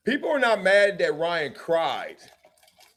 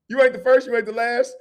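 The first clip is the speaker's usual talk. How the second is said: ordinary talk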